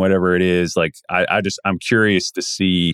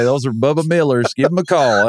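higher-pitched Kentucky hog-seller bit; mock Kentucky accent; 5 semitones higher than usual. Riffing on co-host's slip about the name, mock Kentucky accent. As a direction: play a Kentucky country seller for laughs. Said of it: "sound like he's from Kentucky".

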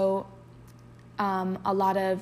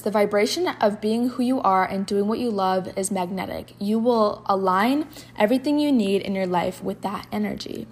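louder, carefully read inspirational quote; 5 dB louder than usual. Acted. Host reading a quote to her listeners, declaiming. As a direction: read it like a daily affirmation. Reads a quote card aloud.

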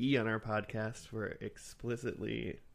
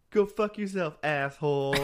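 put-on little girl catchphrase; 3 semitones higher, 6 dB louder than usual. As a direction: belt it like a sitcom kid. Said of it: "Michelle was like".

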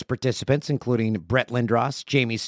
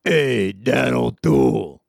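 slow, louder drawn-out sinister greeting; evil voice; 5 dB louder, much slower than usual. Retold or imitated. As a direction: say it slow and menacing. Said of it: "someone who goes".

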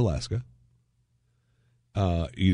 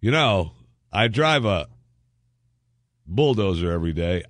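normal talking voice

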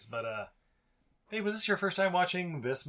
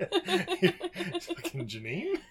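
laughing; much slower than usual. Pretend. Mocking the accent reading.

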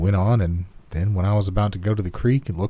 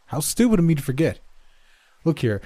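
higher-pitched sudden realisation, then questioning; a father catching on and turning to question his son; lifted, pointed parental questioning; lightly voiced father; 8 semitones higher than usual. A narrator's reading.

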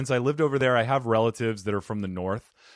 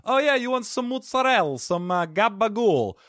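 much higher, hammed-up New Jersey Italian-American accent; 10 semitones higher, 4 dB louder than usual. Podcaster imitating New Jersey Italian-Americans, hammy Jersey accent. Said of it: "Like in New Jersey".

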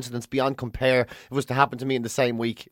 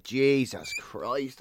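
hungover groan; 3 semitones lower, 6 dB quieter, much slower than usual. Real, not acted. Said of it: "I'm so hungover".

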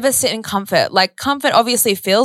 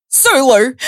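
higher, mock-starstruck "Sue Lou"; hammed-up excited call of "Sue Lou"; excited recognition; 4 semitones higher, 4 dB louder than usual. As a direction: imitate a stranger excitedly spotting "Sue Lou". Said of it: "No, she said it wrong".

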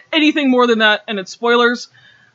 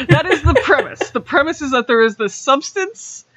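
said through laughter, higher pitched; real laughing; 5 semitones higher than usual. Real, not acted. Host amused by the book's absurd premise, laughing. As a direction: laugh your way into it, voice lifting.

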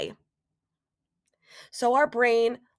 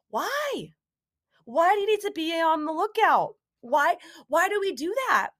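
exasperated why; 6 semitones higher than usual. Real, not acted. Rhetorical exasperated questioning.

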